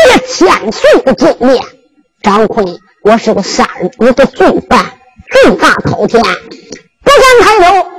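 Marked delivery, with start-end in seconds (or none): 6.2-7.9 high, slow, tearful cry; tearful plea. Acted. Zhang Kun crying as he kneels before the king. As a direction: beg for mercy through tears.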